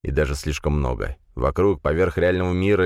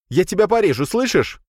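very high, loud frightened yell; panicked shout; 14 semitones higher, 4 dB louder than usual. A narrator's reading. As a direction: scream the threat in panic. Frightened youth threatens with knife.

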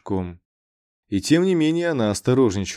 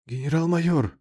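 trembling voice; 6 semitones higher than usual. A narrator's reading. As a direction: voice trembling with nerves. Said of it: "дрожащим голосом".

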